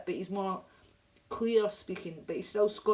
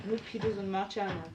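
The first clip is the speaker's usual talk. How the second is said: ordinary delivery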